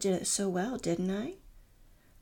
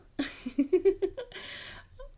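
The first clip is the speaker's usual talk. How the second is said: high teasing laugh; 10 semitones higher than usual. Acted. Laughs at partner pulling cuffs.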